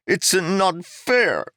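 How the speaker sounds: put-on high, very slow, despairing young voice; groaning complaint. Young Keeg groaning to Jarek about his loss. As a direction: drag out the protest like a wounded boy. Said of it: "Keeg groaned".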